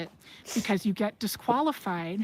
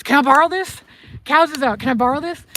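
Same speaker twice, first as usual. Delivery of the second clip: loud, higher, hurried plea; urgent breathless ask; 5 semitones higher, 8 dB louder than usual. Retold or imitated. Friend Brady begging to borrow the horse, loud urgent plea. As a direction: call out like you just ran up needing a horse. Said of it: "he's, like, out of breath".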